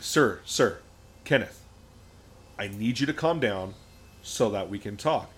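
low, measured de-escalating voice; lightly acted professional composure; trauma-informed social worker. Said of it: "full trauma-informed social worker".

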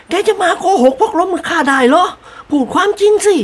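high, insistent mistress voice; demanding lady. A narrator's reading. Mistress demands the truth from her servant.

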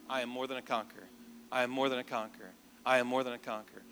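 low, soft repeated affirmation; quiet self-declaration. Retold or imitated. Nervous patient declaring to himself in the car, quietly. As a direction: murmur it to steady yourself.

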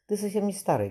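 fervent. A narrator's reading. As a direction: insist with heat, pitch up. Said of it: "горячо проговорила девочка".

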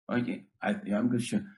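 acted sheepish kid's reply; dejected mumble. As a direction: mumble it half-heartedly, as the kid. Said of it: "he's mumbling to himself".

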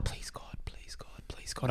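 soft unvoiced pleading whisper; whispered prayer. Driver praying not to be pulled over, whispering. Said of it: "and I'm like".

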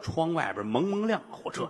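ordinary delivery

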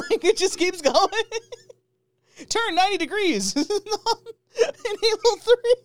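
very high squeal through laughter; words squeezed out between laughs; real hysterical laughing. Real, not acted. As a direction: keep riffing while cracking up. Cracking up while extending the joke.

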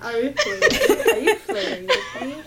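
laughing, high and loud teasing; flirty teasing banter. Real, not acted. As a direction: giggle through a playful flirty question. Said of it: "Oh my God".

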